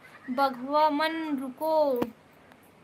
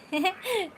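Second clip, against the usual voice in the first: brief, higher-pitched chuckle; short laugh; 4 semitones higher than usual. Real, not acted. Streamer amused by a viewer's wrong spelling, short laugh. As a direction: let out a quick laugh. Laughs after reading misspelt comment.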